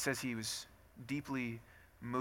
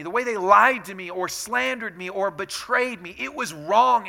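loud, higher, indignant protest; angry sufferer; 7 semitones higher, 10 dB louder than usual. Acted. Voicing someone wronged and betrayed, angry. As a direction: pile up the grievances with rising force. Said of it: "and you're angry".

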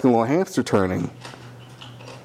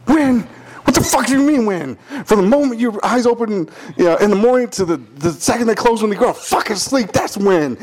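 retold furious rant; 10 semitones higher than usual. Uncle ranting at the employee over his answer, furious high voice. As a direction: rant at him, voice way up in disbelief. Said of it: "He was so mad he started stuttering".

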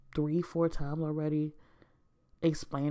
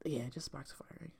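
whispered; 11 dB quieter than usual. Real, not acted. Whispered aside.

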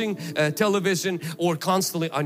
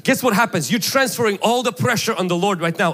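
loud, driving sermon delivery; full force preaching; 6 semitones higher, 3 dB louder than usual. Acted. Preacher urging husbands to yield to Jesus, loudly preaching. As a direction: preach it hard to the room.

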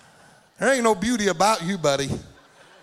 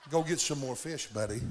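ordinary delivery

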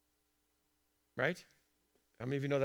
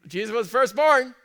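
higher, louder proclamation; preaching shout; 7 semitones higher, 9 dB louder than usual. Real, not acted. Preacher driving home his point to the congregation, shouting. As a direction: belt it out to the room.

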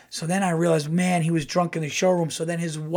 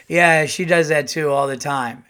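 put-on drunk heckle; 8 dB louder than usual. Drunk heckler shouting from crowd.

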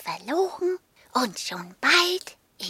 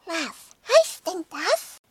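put-on higher, curious child voice; 5 semitones higher than usual. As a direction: ask like a curious little kid. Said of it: "fragten neugierig".

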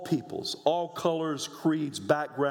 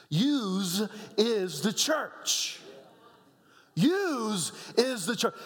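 rising emphatic preaching; 13 semitones higher, much slower than usual. Real, not acted. Preacher building to climax.

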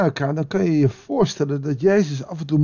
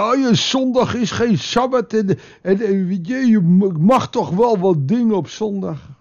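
mock whiny objection, pitched up; a sermon jab at a lax churchgoer's excuse; mocking quote; 5 semitones higher than usual. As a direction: parody the lax believer's excuse. Mocking what some Christians think.

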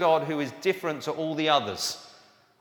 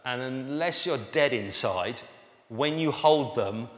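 usual speaking voice

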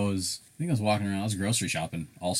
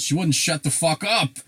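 higher, louder mock defendant snapping back; mock outburst; 8 semitones higher, 5 dB louder than usual. Retelling a judge joke, the husband blurts it out loud. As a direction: toss it off like a fed-up husband.

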